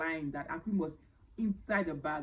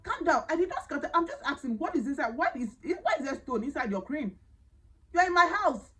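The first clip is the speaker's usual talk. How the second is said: agitated retort; 7 semitones higher than usual. Retold or imitated. Confronting the shouting maid.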